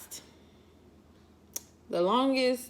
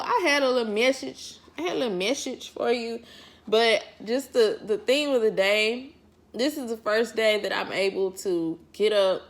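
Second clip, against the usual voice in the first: ordinary talk, nothing marked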